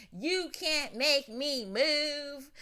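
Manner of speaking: put-on very slow, drawn-out stubborn old man. Old man on the street refusing to move his car, very slow and stubborn. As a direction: drag every word like an old man refusing to budge. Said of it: "he's like".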